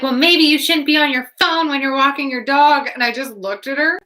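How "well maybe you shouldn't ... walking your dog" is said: higher-pitched imitated telling-off; raised, nagging mock voice; mock scolding. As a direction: mimic a stranger's huffy reproach. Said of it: "she's like".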